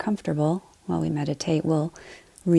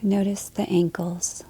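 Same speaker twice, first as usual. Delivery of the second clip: calm body-scan voice, short phrases between long silences; guided meditation; 4 dB quieter than usual. Acted. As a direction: lead them gently through the body, leaving space to breathe.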